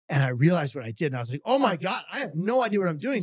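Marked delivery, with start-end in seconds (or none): 1.5-3.2 high, flustered exclamation; imitating his own panicked outburst, pitched up; mock panic. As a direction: blurt it in hammed-up panic. Retold panic on realizing his blunder.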